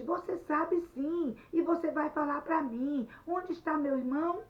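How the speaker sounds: high, loud accusing demand; angry insistence. Acted. Anete presses Luiz about her brother, loud and insistent. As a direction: press him hard for the truth.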